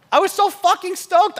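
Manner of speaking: high, loud, gushing recall; loud excited storytelling; elated. Retold or imitated. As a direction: relive the high of being on top. Retelling being stoked in Mallorca.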